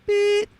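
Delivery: high sharp imitated beep; loud high electronic beep; answering machine beep. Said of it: "listen to her messages like this".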